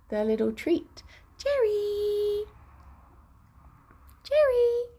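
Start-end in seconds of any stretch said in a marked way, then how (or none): 1.4-4.8 high sing-song call to the bunny; calling a pet. Real, not acted. Streamer calling her bunny Jerry in a high sing-song call.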